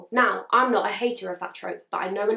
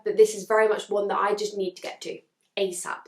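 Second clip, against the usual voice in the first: ordinary talk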